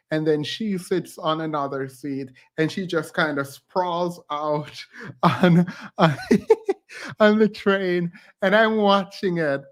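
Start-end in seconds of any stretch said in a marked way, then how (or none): 6.2-6.7 sudden squealing laugh; shrieking laugh caught off guard; high burst of laughter. Real, not acted. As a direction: break into a high giggle. Laughing at stranger's bold move.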